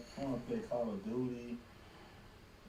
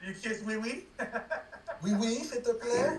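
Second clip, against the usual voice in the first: teasing fake French accent, laughing; hammed-up French phrasing; mock French; 7 semitones higher than usual. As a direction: play up a silly French voice.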